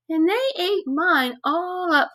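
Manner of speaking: high little cub voice, a bit hurt; Baby Bear. Acted. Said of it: "said Baby Bear".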